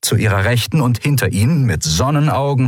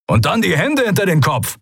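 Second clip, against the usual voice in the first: high, forceful order; commanding; 10 semitones higher than usual. A narrator's reading. Commander to unmasked prisoners, commanding. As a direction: push the next order on them. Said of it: "befahl der Kommandant".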